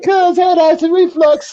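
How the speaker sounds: high, slow imitation; mock rapper. Friend retelling the lyric, high slow mock imitation. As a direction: draw out the line like the rapper. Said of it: "He said".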